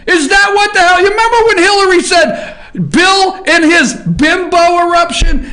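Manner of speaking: high, loud, fast indignant shouting; outraged rant. Real, not acted. Host ranting to viewers about the bimbo eruption, loud and high.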